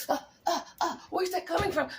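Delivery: high, laughing mock alarm; a hammed-up startled cry through laughter; mock panic. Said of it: "you're like".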